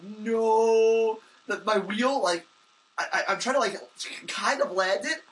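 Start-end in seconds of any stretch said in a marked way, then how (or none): 0.0-1.2 a drawn-out, panicked no, louder and higher; retold panicked no. Rider in midair before crashing, alarmed no. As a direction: cry it out as the crash comes. Mid-air, about to crash bike.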